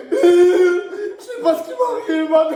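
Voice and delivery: high, slow, wailing fake sobs; hammed-up fake crying to fool the nurse. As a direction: ham up a breakdown for the nurse. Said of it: "en mode acteur".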